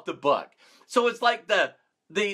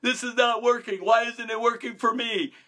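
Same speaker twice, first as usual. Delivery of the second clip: mock upset complaint, pitched a little high; upset complaining; 3 semitones higher than usual. Voicing someone upset that doors start shutting, upset complaint. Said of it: "and you're all upset".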